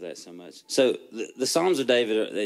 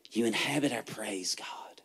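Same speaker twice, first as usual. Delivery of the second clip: softer, hushed whisper; whispered prayer; 6 dB quieter than usual. Acted. David speaking to God in a hushed whisper. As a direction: let it fall to a reverent whisper.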